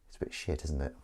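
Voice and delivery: whispered, deflated; whispered aside. Real, not acted. Admitting the toy is rubbish, a whisper. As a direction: breathe it out disappointed.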